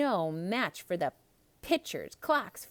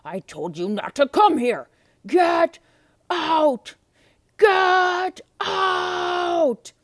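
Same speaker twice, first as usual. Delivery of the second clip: loud, raging beast voice, rising higher; furious beast shouting; 9 semitones higher, 12 dB louder, much slower than usual. Acted. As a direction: drive her out with a roar. Beast rages at Belle.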